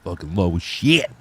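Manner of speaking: deep, soft mimicry; low voice. Cohost echoing how low a friend sounds, deep voice. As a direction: drop your pitch right down.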